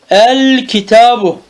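El kitabu with a clear open a on El; fatha on el. Shown as a demonstration. Teacher shows the student the fatha on El. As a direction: punch the opening El of El kitabu with a fatha. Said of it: "Meftu okuyorsun bak".

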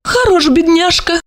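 high wry female voice; wry thought. A narrator's reading. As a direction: think it without malice. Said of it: "беззлобно подумала Ольга".

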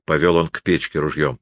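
ordinary delivery

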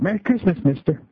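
put-on high child greeting to a stranger; little girl voice. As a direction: chirp it up at the old man. Girl greets homeless man.

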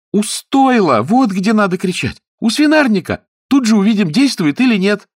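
put-on high, loud, eager girl; inspired excitement. A narrator's reading. Niece proposing the sty for calling, excited high exclamations. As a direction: exclaim each idea high and keen. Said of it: "вдохновенно воскликнула Анжела".

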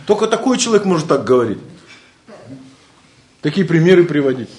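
usual speaking voice

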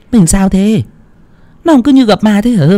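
high, quick wife's question; wife asking her shaken husband, high and quick; wife worried. A narrator's reading. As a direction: ask him what on earth happened.

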